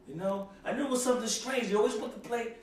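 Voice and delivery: ordinary talk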